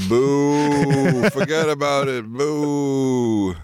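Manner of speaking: spooky New York accent, hammed up; a mock ghostly Boo with New York accent; New York scary voice. Doing the movie's scary voice in New York accent. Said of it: "the scary voice had a New York accent".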